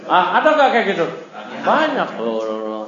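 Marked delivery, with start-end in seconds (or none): 2.2-2.8 low, soft komat kamit chant; mock mumbling spell. Teacher mocking mumbled shaman mantras, low and soft. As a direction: murmur nonsense like a shaman. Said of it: "itu udah komat kamit".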